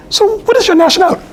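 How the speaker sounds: very high mock British woman; hammed-up, high-pitched British accent. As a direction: ask it in a squeaky bad English accent. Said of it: "They had a British accent".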